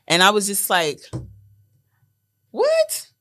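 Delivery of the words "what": very high surprised squeak; a shocked, sky-high "What?". Retold or imitated. Mom reacting to Spanish.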